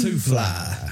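Too Fly with an airy breath pushed in; breathy Too Fly. Shown as a demonstration. Cohost showing how to say the guest's name. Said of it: "You gotta throw the breath in there".

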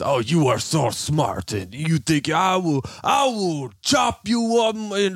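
put-on threatening scammer in muddled Indian-Russian accent; mixed foreign accent. Scammer threatening to chop him up, imitated mixed foreign accent. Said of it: "It's Indian, Mexican, and Russian".

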